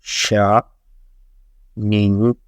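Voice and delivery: "Sha. Ning." each sliding upward; rising second tone. Shown as a demonstration. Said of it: "From the second tone".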